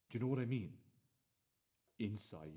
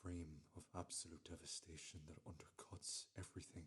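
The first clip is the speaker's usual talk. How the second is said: soft whispered; 7 dB quieter than usual. Acted. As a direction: breathe it out. Whispered chorus line.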